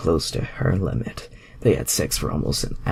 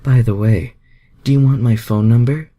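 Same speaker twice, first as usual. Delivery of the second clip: high, loud, teasing female voice; a playful Southern belle asking; flirty Rogue; 8 semitones higher, 9 dB louder than usual. A narrator's reading. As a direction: tease him high and bright. Rogue character dialogue.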